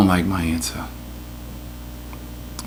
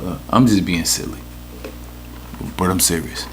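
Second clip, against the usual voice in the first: usual speaking voice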